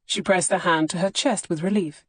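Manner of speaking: ordinary delivery, nothing marked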